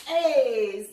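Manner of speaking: ordinary delivery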